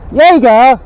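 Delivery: loud, high praise for the dog; praising the dog. Real, not acted. Owner rewards his dog, loud and high. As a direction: cheer the dog on from a distance.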